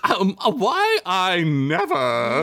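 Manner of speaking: high, slow prissy gasp of outrage; mock offended lady. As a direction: clutch your pearls, very high.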